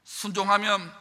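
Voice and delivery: ordinary talk, nothing marked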